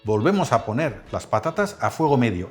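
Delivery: ordinary delivery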